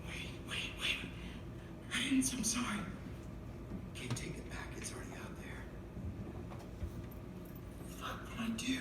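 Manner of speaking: breathless whispered backpedal; panicked whisper. Retold or imitated. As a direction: whisper it like you're caught. Panicked after releasing the album.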